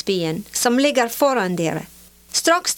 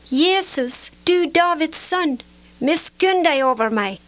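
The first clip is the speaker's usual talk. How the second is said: higher-pitched, restrained cry for mercy; calling out; 5 semitones higher than usual. A narrator's reading. Blind beggar calling to Jesus from the roadside, calling out. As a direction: call out pleadingly in a raised pitch. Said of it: "satte han i å rope".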